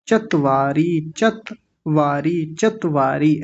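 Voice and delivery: चत्वारी slowly as chat-vaa-ree; one t in चत्वारी, long vowels; single t. Shown as a demonstration. Teacher drilling the class, चत्वारी with single t. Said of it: "ऐसा बोलेंगे".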